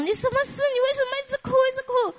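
put-on high, quick children asking why she cries; quick, high mock child pleading; children's voices. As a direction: pitch up like anxious kids. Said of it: "孩子们就来找我".

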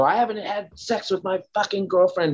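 mock his angry demand; angry forceful. Man angrily blaming his roommate's houseguest, retold forceful. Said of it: "like, angry forceful".

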